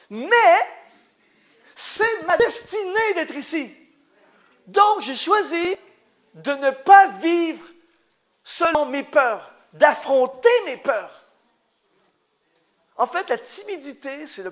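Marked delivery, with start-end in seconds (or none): 1.7-11.2 slow, weighty, word by word; slow emphatic declaration. Acted. Preacher declaring to the congregation that he faces fear, slow and emphatic. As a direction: hammer each phrase slowly.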